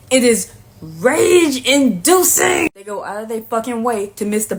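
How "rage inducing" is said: RAGE-INDUCING stretched long and higher; drawn-out exasperation. Real, not acted. Ranting to viewers about men who play stupid, exasperated and drawn out. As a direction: draw out "rage-inducing" slowly, pitch climbing.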